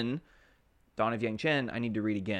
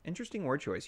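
quieter repeat; 5 semitones higher, 6 dB quieter, faster than usual. Shown as a demonstration. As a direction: say Interesting word choice again under your breath.

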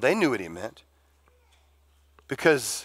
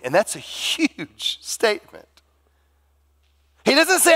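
ordinary delivery

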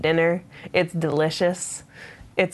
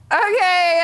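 very high okay; comply in a hammed-up high voice; meek mock compliance; 14 semitones higher, 4 dB louder than usual. She recalls obeying the border guard, high mock compliance.

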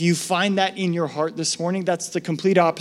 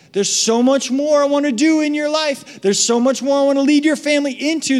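pleading God; 8 semitones higher than usual. Retold or imitated.

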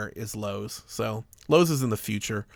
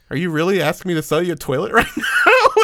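higher, louder question broken by real giggles; giggling disbelief; 6 semitones higher, 6 dB louder than usual. Retold or imitated. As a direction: laugh through the question in disbelief. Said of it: "Yeah, I was giggling".

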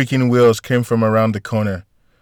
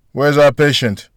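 higher, louder call from afar; mildly raised, carrying question; 4 semitones higher, 7 dB louder than usual. A narrator's reading. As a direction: call it round the corner. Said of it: "a voice called out from the corner".

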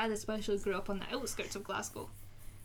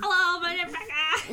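slow, higher and louder Cockney voice; Cockney impression; 7 semitones higher, 6 dB louder than usual. Becca doing herself as a comic Cockney kid, slowly. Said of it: "the less Cockney version".

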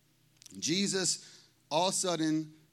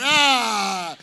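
a sudden delighted "ah!" shout, pitched up and loud; 4 semitones higher, 10 dB louder than usual. Real, not acted. Pastor to the congregation, delighted by his own joke, bursting out with an "ah!". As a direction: burst out with a joyful "ah!".

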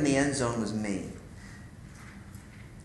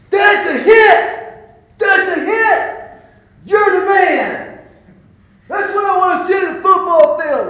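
coach yelling; 12 dB louder than usual. Retold or imitated. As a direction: bellow it like a coach shaking his player. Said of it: "He goes".

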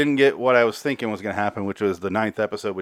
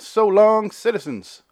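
high, loud showy farewell on So long; host's big catchphrase goodbye to listeners; grand sign-off; 10 semitones higher, 4 dB louder, slower than usual. Acted. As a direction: announce the goodbye like a hammy hero.

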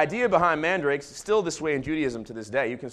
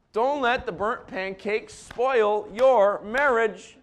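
slow, louder, higher; emphatic plea; 6 semitones higher, 5 dB louder, much slower than usual. Real, not acted. Pastor pleading with married couples, slow and loud. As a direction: drive the line home. Key sermon line.

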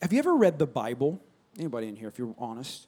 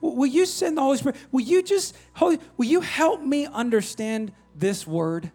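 pleading prayer; 8 semitones higher, 3 dB louder than usual. Pretend.

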